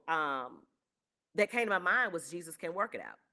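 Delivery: normal talking voice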